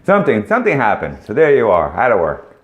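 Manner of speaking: ordinary delivery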